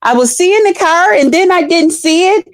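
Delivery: high, fussing worried voice; worried lady. Retold or imitated. Passenger relieved to see the car, high and fretful. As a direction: fuss over the driver. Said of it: "she said".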